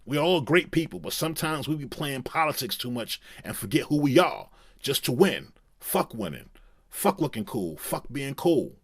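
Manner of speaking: [name] impression. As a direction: preach it to the crowd like Kanye mid-monologue. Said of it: "I can't do the [name] an-, uh, accent".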